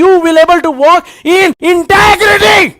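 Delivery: slow shouted climax; preaching climax. Real, not acted. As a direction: belt it out, stretching the last words. Preacher building to emphatic climax.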